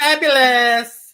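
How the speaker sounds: high, loud, drawn-out designer gush; Nate Berkus. Retold or imitated. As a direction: sing out the praise like a showy designer. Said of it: "the whole Nate Berkus impersonation".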